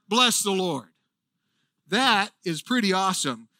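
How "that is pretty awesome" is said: ordinary delivery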